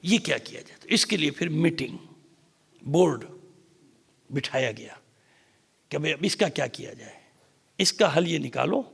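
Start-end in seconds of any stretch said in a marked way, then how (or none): none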